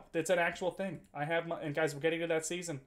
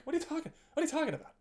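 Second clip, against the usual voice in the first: much higher, fast mock confusion; imitating confused self; 13 semitones higher, 5 dB louder, faster than usual. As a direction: blurt it out like you've lost the thread. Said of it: "I'm like".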